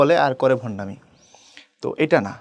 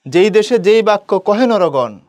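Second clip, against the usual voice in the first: slow, loud, raised-pitch poem line; verse recitation; 5 semitones higher, 7 dB louder, slower than usual. Acted. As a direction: declaim the verse slowly and loudly. Teacher recites a quoted verse line.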